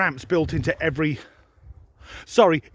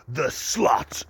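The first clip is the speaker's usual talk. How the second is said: whispered dirty aside; sleazy whisper; 5 semitones lower than usual. Acted. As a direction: breathe it like a seedy secret. Imagined car lover's dirty aside.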